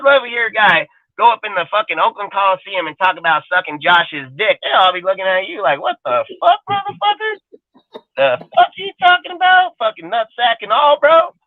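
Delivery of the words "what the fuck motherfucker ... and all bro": high-pitched mock outrage; incredulous crowd reaction. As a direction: squeal it like a baffled onlooker. Imagined crowd reacting in disbelief.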